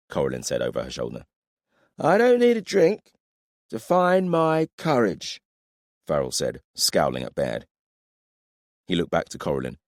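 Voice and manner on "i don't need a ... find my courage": put-on drunk. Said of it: "You're drunk".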